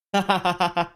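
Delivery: loud high gleeful laugh; thrilled laughter; excited laugh. Acted. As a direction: laugh out in delight. After no way.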